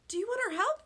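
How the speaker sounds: high, loud eager pals; pals asking. A narrator's reading. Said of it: "they asked".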